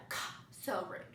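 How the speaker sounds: whispered under breath; muttered aside. Real, not acted. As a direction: mutter it so he barely hears. Muttering at boyfriend.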